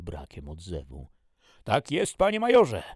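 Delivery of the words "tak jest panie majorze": startled, high, loud soldier's reply; shouting back. A narrator's reading. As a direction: snap out of a daydream and shout. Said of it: "odkrzyknął wyrwany z zamyślenia". (odkrzykuje wyrwany z zamyślenia)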